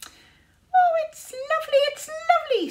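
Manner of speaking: very high, loud tiny squeak; squeaky little sprite. Acted. Sprite Squeeze Juice cooing over the glade, high squeak. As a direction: squeal it like an excited little fairy. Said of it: "squeaked Squeeze Juice".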